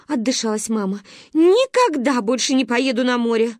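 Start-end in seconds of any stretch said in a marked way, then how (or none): 1.3-3.6 upset vow. Acted. Mama vows after nearly drowning.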